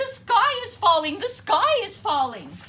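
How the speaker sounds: high, loud, fast panic; panicked. A narrator's reading. Hen warning everyone the sky falls, panicked loud cry. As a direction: squawk it out in terror.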